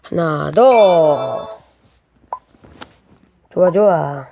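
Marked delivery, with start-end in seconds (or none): 0.0-1.6 slow drawn out. Acted. Game reaction, drawn out.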